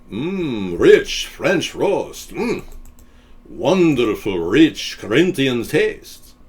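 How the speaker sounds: slow, relishing coffee commercial; savouring ad voice. Pretend. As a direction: linger over every word.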